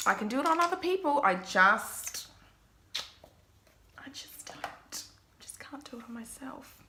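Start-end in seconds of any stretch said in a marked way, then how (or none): 4.0-6.8 softer breathy whisper. Real, not acted. Admits to viewers she can't do her own makeup, whispering. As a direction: confide it under your breath.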